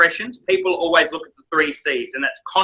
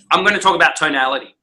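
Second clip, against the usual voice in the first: usual speaking voice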